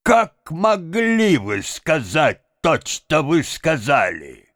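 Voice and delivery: high, slow indignant demand; outraged reproach. A narrator's reading. Benchik challenges what his father said, outraged reproach. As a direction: raise the pitch in disbelief and reproach.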